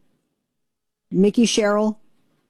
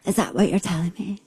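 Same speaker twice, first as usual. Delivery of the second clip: imitating Jill Biden; 3 dB quieter than usual. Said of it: "I feel like I should be her for Halloween".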